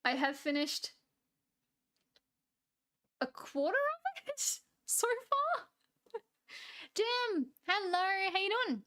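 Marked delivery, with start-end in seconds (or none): 3.2-5.7 amused admission. Real, not acted. Amused admission about eating the cake. 6.9-8.8 cheery greeting. Real, not acted. Greeting a chat viewer.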